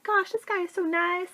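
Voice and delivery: high, dreamy gushing; smitten. Retold or imitated. Girl after night out clubbing, imitated smitten gushing. Quotes her smitten thought.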